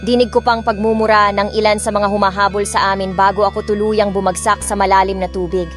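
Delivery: ordinary delivery, nothing marked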